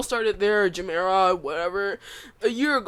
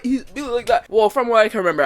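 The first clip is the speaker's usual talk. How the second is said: ordinary talk, nothing marked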